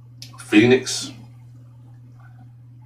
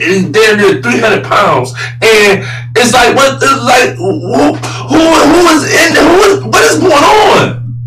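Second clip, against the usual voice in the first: incredulous shouting; 12 semitones higher than usual. Real, not acted. Host ranting about the Pelicans mishandling Zion, shouting in disbelief. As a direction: voice climbing high into an exasperated shout.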